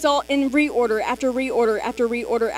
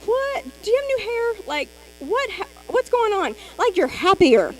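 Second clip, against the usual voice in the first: high-pitched disbelief, each question jumping up; amazed family member; 8 semitones higher than usual. Retold or imitated. A family member stunned by the new glow, squealing with amazement. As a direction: gush like a stunned relative who can't believe the change. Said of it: "their family's gonna be like".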